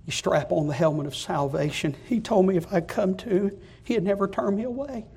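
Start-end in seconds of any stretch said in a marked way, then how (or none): none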